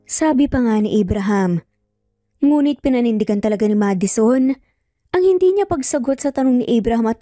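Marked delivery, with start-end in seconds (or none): none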